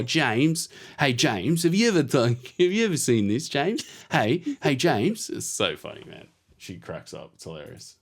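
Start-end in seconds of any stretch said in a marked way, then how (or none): 0.0-5.3 higher-pitched, talkative Aussie cat voice; be a chattering Aussie cat, pitched up; Chatterpuss. Retold or imitated. Aussie cat talking to a hen, in an Aussie accent. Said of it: "I always do this accent".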